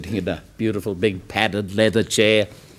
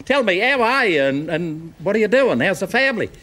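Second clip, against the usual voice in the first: put-on eager old schoolmate; 10 semitones higher than usual. Schoolmate greeting old friend.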